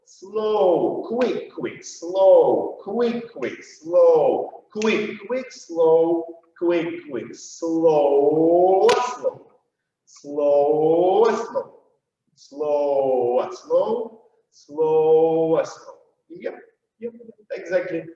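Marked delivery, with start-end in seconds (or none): 0.1-8.9 slow-quick-quick count. Shown as a demonstration. Dance teacher counting the rhythm, slow-quick-quick. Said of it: "When we'll be doing slow, quick, quick". 8.9-15.9 long SLOW, brief SLOW straight after; drawn-out count. Shown as a demonstration. Dance teacher counting in time, drawn-out then quick. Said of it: "an immediate reference to slow".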